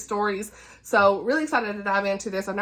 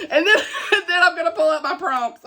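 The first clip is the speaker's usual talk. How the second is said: laughing; 7 semitones higher, 5 dB louder than usual. Real, not acted. Laughing at her own fumble.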